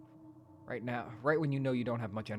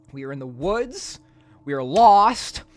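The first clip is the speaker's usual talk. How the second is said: dramatic announcement; 5 semitones higher, 16 dB louder, slower than usual. Acted. Dramatic line read from game.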